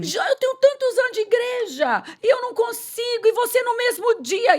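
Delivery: high-pitched imitation of envious churchgoers; resentful complaint. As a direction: mimic a wounded, indignant lament. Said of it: "começassem até a ficar ressentidas". (imitando queixa ressentida, em voz bem aguda)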